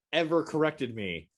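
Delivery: normal talking voice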